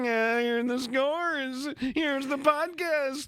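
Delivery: put-on high slow old announcer voice; slow high-pitched recording voice; Fred Hubner. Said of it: "It's Fred Hubner telling".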